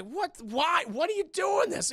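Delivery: a hammed-up dying disbelief; mock disbelief. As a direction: plead it in shocked betrayal.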